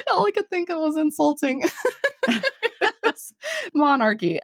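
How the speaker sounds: high, breaking into laughter mid-sentence; embarrassed giggling; laughing. Real, not acted. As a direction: laugh helplessly through it. Laughing at own embarrassing remark.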